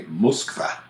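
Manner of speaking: "Moskva" as "musk-VAH"; Russian "Moskva" with final stressed "vah"; Russian pronunciation. Retold or imitated. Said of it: "They say".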